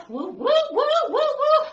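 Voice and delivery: high, loud woo-woo cheer. Pretend. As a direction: whoop it up like a fan. Cheering for the Super Bowl.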